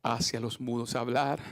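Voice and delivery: soft, prayerful sermon voice; hushed preaching. Acted. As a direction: drop to a near murmur to draw them in. Hushed intimate sermon passage.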